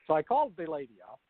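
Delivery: ordinary talk, nothing marked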